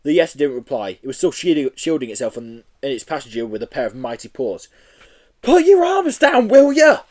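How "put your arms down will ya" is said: loud, slow, very high plea; pleading. Acted. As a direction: beg him desperately. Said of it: "pleaded Joe".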